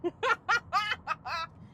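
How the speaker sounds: high-pitched laughter; laughing. Real, not acted. Laughing at own bad French, amused. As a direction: burst out laughing at yourself.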